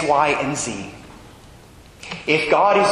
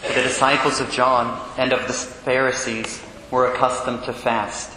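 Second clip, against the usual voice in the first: formal Gospel proclamation; cathedral echo; 5 semitones lower, 3 dB quieter than usual. Acted. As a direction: reverent liturgical reading. Priest proclaims the Gospel at Mass.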